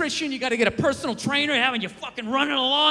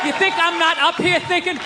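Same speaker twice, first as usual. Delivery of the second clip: ordinary talk, nothing marked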